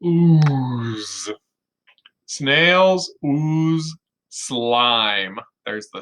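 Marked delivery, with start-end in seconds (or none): 0.0-1.3 put-on ooze, slow and drawn out for the kids. Shown as a demonstration. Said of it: "Let's say it again". 2.2-5.4 very slow, each word of Snails ooze slime stretched; slow, drawn-out read to kids; slow reading aloud. Shown as a demonstration. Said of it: "Let's say it again".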